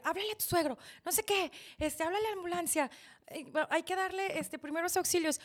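loud, high, frantic instructions; retold urgent orders. Relatives call for an ambulance, frantic and loud. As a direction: fire off orders in an emergency.

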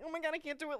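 lightly acted panic; freaking out. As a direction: retell his flustered freak-out. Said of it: "like, freaking out".

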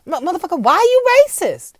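rising into a high, loud shout; hammed-up angry demand; mock confrontation. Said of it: "Maybe we should ask that question".

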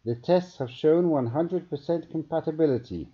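high, savouring scientist voice. A narrator's reading. Scientist reporting to his captain, savouring. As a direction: relish every word. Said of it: "Juranga spoke slowly".

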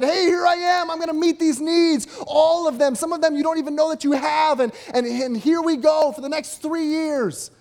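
loud, high, brash self-promoting Jesus; mock hype announcement. Preacher voicing a showy Jesus announcing his arrival. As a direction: hype it up like a publicity tour. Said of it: "He got on the scene and said".

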